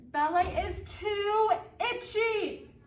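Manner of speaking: put-on loud, high complaining child; loud whine. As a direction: whine it at the ballet teacher. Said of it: "I said".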